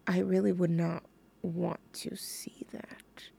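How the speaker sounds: whispered aside; whispering. Real, not acted. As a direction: drop to a whisper. Embarrassed aside to listeners.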